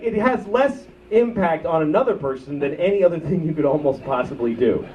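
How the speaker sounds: ordinary delivery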